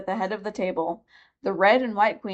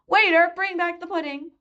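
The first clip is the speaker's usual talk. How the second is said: calling out; 10 semitones higher, 3 dB louder than usual. A narrator's reading. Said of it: "she called out".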